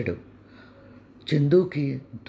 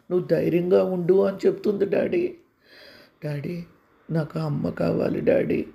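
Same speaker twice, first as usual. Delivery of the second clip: put-on higher, childlike boy telling his father; a small sick boy talking to daddy; young boy voice; 4 semitones higher than usual. Narrator voices the child Chandu. (చిన్న పిల్లవాడి గొంతు)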